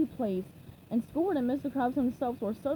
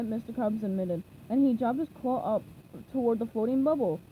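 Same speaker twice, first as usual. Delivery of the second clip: usual speaking voice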